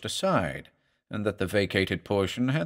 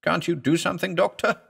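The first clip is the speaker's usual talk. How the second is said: patient in agony begging for help; agonized plea; 7 semitones higher than usual. A narrator's reading. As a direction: beg the doctor as the pain grips. Patient begs doctor in agony.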